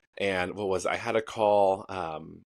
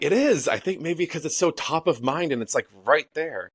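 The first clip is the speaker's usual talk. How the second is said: excited agreement; 7 semitones higher, 3 dB louder than usual. Retold or imitated. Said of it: "I'm like".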